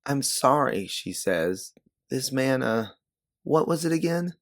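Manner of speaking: a lightly voiced flustered girl; Sabine's voice. A narrator's reading. Sabine apologizing to her angry friend, flustered.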